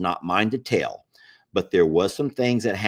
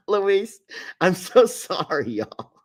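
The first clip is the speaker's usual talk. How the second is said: laughing apology; 9 semitones higher, slower than usual. Real, not acted.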